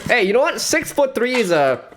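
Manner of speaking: usual speaking voice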